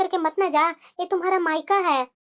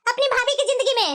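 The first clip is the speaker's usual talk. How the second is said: scolding; 8 semitones higher than usual. Acted. Mother scolds meddling daughter.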